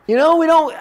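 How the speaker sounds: words spilling out through a laugh. Real, not acted. Preacher laughing at his own mock line. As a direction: still laughing at the joke.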